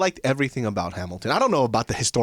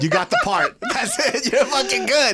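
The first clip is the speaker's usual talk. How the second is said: laughing; 12 semitones higher, 4 dB louder than usual. Real, not acted. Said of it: "laughing praise after song".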